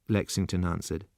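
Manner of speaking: plain reading voice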